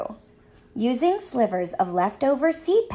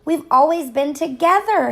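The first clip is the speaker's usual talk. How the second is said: howl; 6 semitones higher, 5 dB louder than usual. A narrator's reading. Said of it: "Harriet howled".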